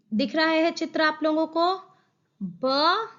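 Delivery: normal talking voice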